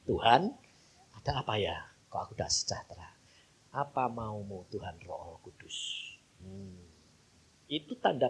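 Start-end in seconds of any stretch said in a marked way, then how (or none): 0.0-6.8 quiet prayer. Retold or imitated. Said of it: "langsung berdoa".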